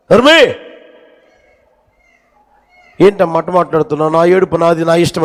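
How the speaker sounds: retold mother's angry retort, half acted; bitter outburst. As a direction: snap back at the neighbor through the grief. Grieving mother lashes out.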